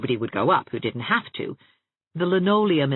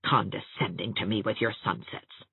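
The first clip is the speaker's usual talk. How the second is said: low, soft bitter thought; bitter inner thought; 5 semitones lower, 7 dB quieter than usual. A narrator's reading. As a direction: mutter it inwardly with resentment. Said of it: "she thought bitterly".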